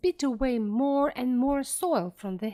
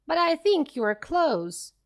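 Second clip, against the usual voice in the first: shout; 6 semitones higher, 4 dB louder than usual. A narrator's reading. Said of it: "shouted Bogies".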